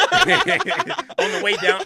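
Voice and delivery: loud, high words through laughter; laughing. Real, not acted. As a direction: keep the joke going while cracking up. Laughing on at robot joke.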